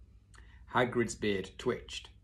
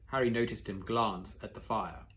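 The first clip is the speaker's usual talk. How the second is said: usual speaking voice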